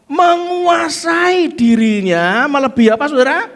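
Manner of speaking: loud, high call to the crowd. Acted. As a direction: shout the question out to the church. Calling out to congregation.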